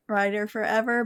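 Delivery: ordinary talk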